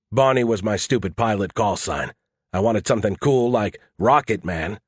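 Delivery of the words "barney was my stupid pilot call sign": ordinary delivery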